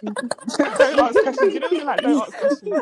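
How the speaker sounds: high, loud, laughing mock plea. Laughing plea while telling embarrassing story.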